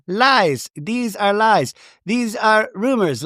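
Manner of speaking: high, emphatic mimicked denials; mock accusing. [name] mimicking her accuser's denials, mock-indignant. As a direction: imitate someone dismissing everything in punchy high bursts.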